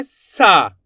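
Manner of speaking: सा with a long, forceful आ; stressed long saa. Shown as a demonstration. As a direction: push the vowel of सा hard. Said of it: "जोर लगा देंगे". (ज़ोर लगाकर खींचा हुआ सा)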